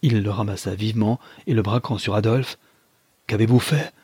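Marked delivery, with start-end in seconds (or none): 3.3-3.9 mildly raised, alarmed question; acted shocked challenge; exclaimed question. A narrator's reading. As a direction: demand it in alarm. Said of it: "s'écria-t-il".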